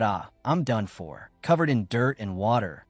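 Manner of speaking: usual speaking voice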